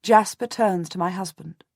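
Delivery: ordinary delivery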